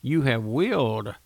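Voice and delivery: "willed" held long and stressed. Shown as a demonstration. Preacher pressing the word on his listeners, "willed" stretched. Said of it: "And I say it like this".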